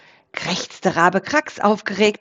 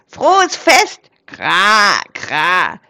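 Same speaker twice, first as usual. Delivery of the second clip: croaking crow; 5 dB louder, much slower than usual. A narrator's reading. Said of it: "krächzte Krax".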